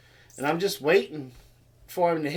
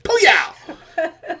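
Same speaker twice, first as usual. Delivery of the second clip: loud, high exploding exclamation; imitated flick noise; 11 semitones higher, 8 dB louder than usual. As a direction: burst out like a violent flick. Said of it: "He's like".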